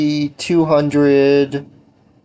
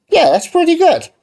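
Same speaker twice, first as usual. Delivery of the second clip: excited; 6 dB louder than usual. Real, not acted. Pleased reaction while playing.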